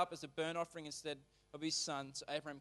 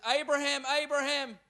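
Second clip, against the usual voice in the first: calling out; 6 semitones higher, 5 dB louder than usual. Acted. Angel calls from heaven.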